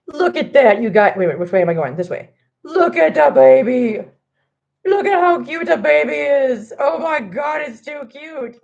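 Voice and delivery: real delighted cooing at a cute kitten; cooing over kitten. Real, not acted. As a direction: gush over the kitten photo.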